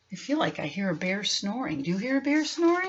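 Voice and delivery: put-on playful stage whisper to kids; hushed tease. Teasing aside to children.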